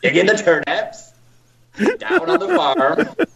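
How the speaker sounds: put-on broad rural farmer accent; slow farmer accent, climbing high on the farm. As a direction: play up a yokel farmer accent. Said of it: "So you do a farmer".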